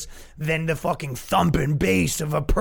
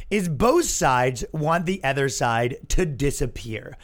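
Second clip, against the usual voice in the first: normal talking voice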